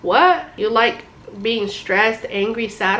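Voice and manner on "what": sharp high, loud disbelief; a stunned, loud what?; incredulous what. Real, not acted. Reacting to people accepting toxicity.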